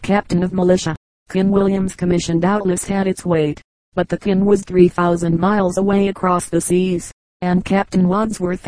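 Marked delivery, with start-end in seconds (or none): none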